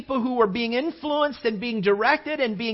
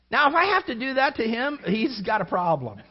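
ordinary talk, nothing marked

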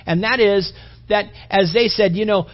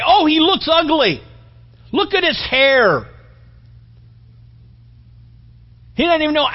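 sneering mockery; 7 semitones higher, 4 dB louder than usual. Political critics mocking Trump.

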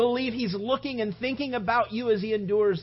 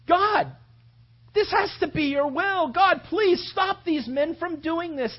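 higher, louder, slow desperate plea; anguished prayer; 7 semitones higher, 4 dB louder than usual. Acted. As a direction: disciples crying out to God. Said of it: "they prayed that".

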